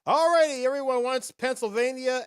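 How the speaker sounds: loud, high-pitched announcement; excited quiz host. Real, not acted. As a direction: burst in big to reveal the answer.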